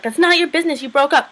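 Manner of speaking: high, emphatic comeback; mock indignant retort. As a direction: imitate a girl arguing back. Retold quarrel, snapping back.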